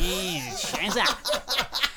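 high, loud, drawn-out Aussie caricature; put-on Aussie accent. Winding up the apprentice at work, hammed-up Aussie accent. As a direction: ham up an Australian accent, stretched and laughing. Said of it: "use your Aussie accent".

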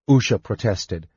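ordinary delivery, nothing marked

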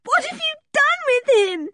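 high-pitched tearful demand; distraught, very high crying voice; sobbing. A narrator's reading. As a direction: wail the question through sobs. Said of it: "she sobbed".

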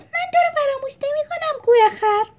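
very high small voice; little Pliny reassuring the zebra; Pliny, tiny friend. Acted. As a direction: comfort him in a tiny sweet voice.

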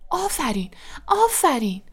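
mildly excited congratulation, pitch up; congratulating. A narrator's reading.

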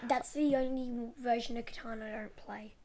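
whispered aside; whispering. Real, not acted. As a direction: say it under your breath. Whispered aside about disliked expansion.

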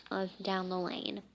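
ordinary delivery

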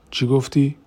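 taken aback. A narrator's reading. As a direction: demand it as if caught off guard. Confronted with threat to tell. (با تعجب و صدای بلندتر)

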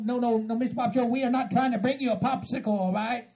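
ordinary delivery

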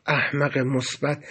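low, soft and slow; exasperated. A narrator's reading. Cellmate with face in hands, quietly exasperated. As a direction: sigh it out in weary resignation. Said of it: "صورتش رو بین دستاش گرفت و گفت".

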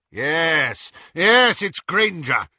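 high, loud, eager confirmation; excited cry. A narrator's reading. Lucius confirming it's Granger, crying out. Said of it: "cried Lucius".

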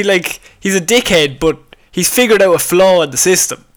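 ordinary talk, nothing marked

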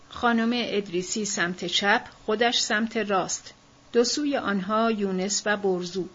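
usual speaking voice

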